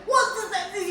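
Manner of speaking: high, loud panicked shout. Retold or imitated. Child repeating dad's math question, high panicked shout. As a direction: yell the question back in panic. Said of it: "you start shouting".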